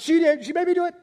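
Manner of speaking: put-on high, loud, childish blaming voice; a caught man pointing at his wife; whiny Adam. Adam blaming Eve to God, high whiny protest. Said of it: "Adam says".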